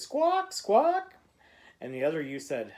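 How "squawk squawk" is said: very high, loud squawks; bird squawk. A narrator's reading. Child trapped as a bird, squawking: high loud squawk. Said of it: "all you could say was".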